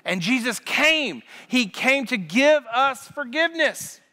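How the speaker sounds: high, loud rousing sermon; impassioned pulpit preaching; full-force preaching. Acted. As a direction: build up the congregation.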